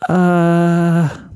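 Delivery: high, prissy unicorn lady; prim Rarity voice. Acted. Rarity startled at Fluttershy's jacket, high and prim. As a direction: play the fussy fashionista.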